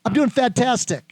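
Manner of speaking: beaming, upbeat "I'm doing fantastic."; enthusiastic answer. Shown as a demonstration. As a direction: sell "fantastic" with real energy. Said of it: "How you doing?".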